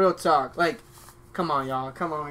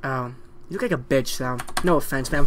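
usual speaking voice

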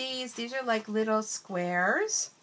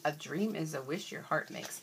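usual speaking voice

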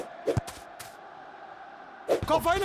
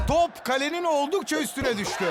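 ordinary delivery

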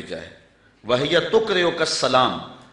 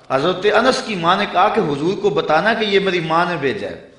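ordinary talk

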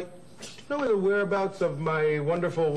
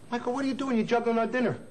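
startled outcry; 6 semitones higher, faster than usual. Acted. Catches Michael juggling dinner.

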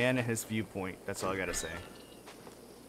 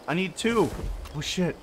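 usual speaking voice

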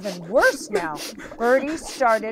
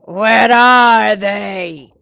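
loud, slow menacing shark voice; demanding shark; 10 dB louder, much slower than usual. Acted. Shark hunts the hidden fish, loud slow demand. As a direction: boom the question out slowly. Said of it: "demanded the shark".

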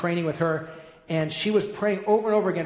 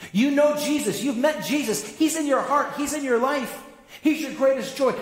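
higher, louder, urging them on; fervent insistence; 7 semitones higher, 3 dB louder than usual. Real, not acted. Preacher urging the congregation, fervent and raised. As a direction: press the point home with conviction. Preacher rebuts excuse with fervour.

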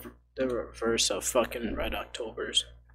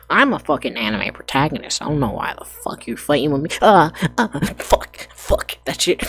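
anime protagonist voice; 10 semitones higher, 9 dB louder than usual. Acted. Anime hero voice, pained.

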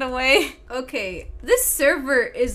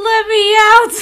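high, loud cry to be let out; panicked shout; 9 semitones higher, 12 dB louder, faster than usual. Pretend. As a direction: shout it as if trapped. Pretending trapped child.

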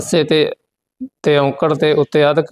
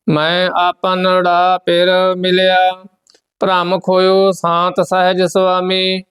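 steady, measured chant of the verses; scripture recitation; 4 semitones higher, slower than usual. Acted. Teacher recites Gurbani verses for the students, steady and measured. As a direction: recite each line evenly, with devotion.

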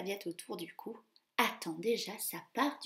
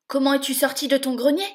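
higher, very loud, fast yelp; shrieking stepmother; 5 semitones higher, 13 dB louder, faster than usual. Acted. Stepmother to escaped Cinderella, angry yelp. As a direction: screech at Cinderella. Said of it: "glapit la marâtre".

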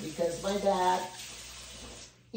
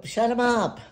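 bossy order; 8 semitones higher, 6 dB louder than usual. Retold or imitated. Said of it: "She would go".

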